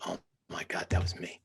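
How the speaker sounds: stunned whisper. Retold or imitated. Seeing the dads on phones, hushed and stunned. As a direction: breathe it out in shock. Retold shocked realization.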